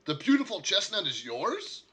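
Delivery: high, loud surprised NPC voice; curious shopkeeper. Acted. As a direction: play him eager and surprised. GM voices NPC questioning horse.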